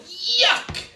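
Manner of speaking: high, loud disgusted yell; monster yell. Acted. Monster kissed by Winifred, a high loud disgusted yell. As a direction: shriek it in disgust. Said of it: "The monster yelled".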